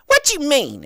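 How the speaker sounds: high, loud, indignant mock whine; hammed-up snippy customer; imitating the rude customer. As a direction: play her as the offended customer. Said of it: "And she's like".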